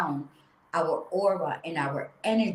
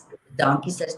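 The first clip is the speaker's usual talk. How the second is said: normal talking voice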